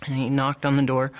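usual speaking voice

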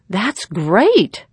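bright, excited burst; light up at the news; girl thrilled by the money; exclaiming. A narrator's reading. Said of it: "she exclaimed".

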